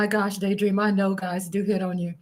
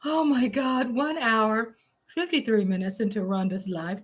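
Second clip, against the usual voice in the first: ordinary delivery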